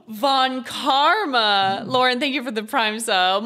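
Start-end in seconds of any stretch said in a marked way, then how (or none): 0.1-1.8 thrilled exclamation. Real, not acted. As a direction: stretch the name out with excitement. Excited at seeing Von Karma.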